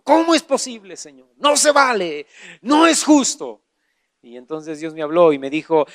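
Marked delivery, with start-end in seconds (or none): 0.0-3.6 acted-out protest to God, pitch jumping up; mock-aggrieved pleading, leaning on each phrase; indignant complaint. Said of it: "me enojé con Dios".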